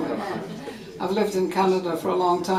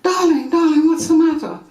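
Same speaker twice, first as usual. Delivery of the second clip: higher, louder anxious call; lightly voiced worried mother; 8 semitones higher, 4 dB louder than usual. Mother running from the house to her child, mock worried call. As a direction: rush out calling the child. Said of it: "she says".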